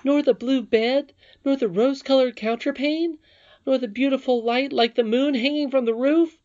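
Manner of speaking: very high, loud, insistent questions; incredulous princess. A narrator's reading. Princess presses Curdie about the room, high and incredulous.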